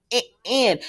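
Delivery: stuttering on one broken syllable; halting mock stutter, syllable by syllable; imitated stammering. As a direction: mimic someone stuck stammering. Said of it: "She starts stammering and stuttering again".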